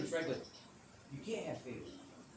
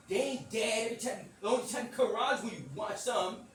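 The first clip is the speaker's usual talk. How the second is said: loud, higher-pitched complaint; high, loud mock scolding; child's scolding; 6 semitones higher, 6 dB louder than usual. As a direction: call Dad out like a fed-up kid. Acting kid scolding dad.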